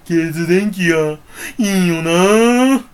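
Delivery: slow, drawn-out attempt at Shimura Ken's voice; a loose take on Shimura Ken; slow half-hearted Shimura Ken voice; Shimura Ken impression. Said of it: "志村けんのモノマネ".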